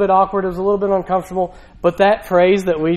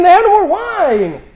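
pleading Mary; 9 semitones higher than usual. Retold or imitated. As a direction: beg your son to fix it. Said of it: "she's pleading almost with Jesus".

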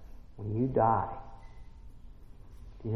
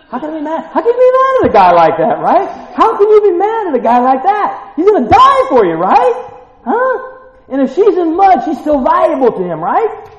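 much higher and louder, fired-up preaching; preacher shouting to the congregation about a love worth dying for; excited shouting; 14 dB louder than usual. Real, not acted. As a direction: shout it out with joyous fervor.

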